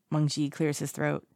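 ordinary delivery

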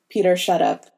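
ordinary delivery, nothing marked

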